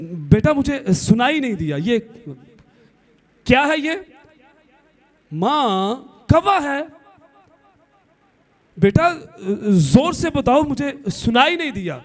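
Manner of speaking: a mother straining to hear; old mother. Retold or imitated.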